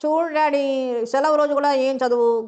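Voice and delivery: slow, wheedling plea to daddy; coaxing. A narrator's reading. Spoiled daughter coaxing her father, slow wheedle. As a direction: drawl it out sweetly to win him over. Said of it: "గోముగా తండ్రి వంక చూసింది".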